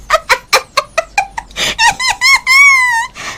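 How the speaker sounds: very high long shrieking cackle; evil witch cackle. Acted. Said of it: "और फिर जोर से हंसी।".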